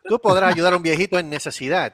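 cheesy charity ad voice; mock commercial read. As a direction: sell it like a TV charity appeal.

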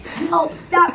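high, small begging voice; pleading possum voice. Pretend. Voicing the injured possum begging her to stop, high and pleading. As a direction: play the crawling possum pleading.

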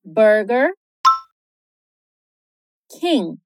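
Burger and King said apart in American English; English Burger King, word by word; slow syllable split. Shown as a demonstration. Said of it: "a pronúncia em inglês é".

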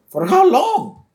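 loud, very high-pitched cry; mock protest. Student protesting detention to principal, high mock wail. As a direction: cry out in hammed-up dismay.

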